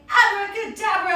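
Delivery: playful magician's abracadabra; magic word. Pretend. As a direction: announce the spell like a stage magician. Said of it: "And we say".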